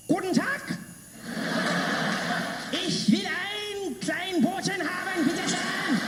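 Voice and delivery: high, slow German barked as a shouted speech; Hitlerish German. Retold or imitated. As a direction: hammer the sandwich order like a ranting dictator. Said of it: "sometimes I sound distinctly Hitlerish".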